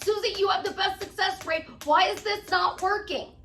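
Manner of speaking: high, loud mock protest; upset client. Client demanding answers from the coach, mock upset. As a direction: plead in exaggerated frustration, pitched well up. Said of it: "they will get upset".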